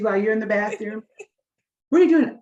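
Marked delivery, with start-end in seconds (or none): 1.9-2.4 high, loud startled question; mock panicked boyfriend, higher and louder; alarmed boyfriend. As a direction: blurt it out, caught off guard. Imagined boyfriend catches her packing.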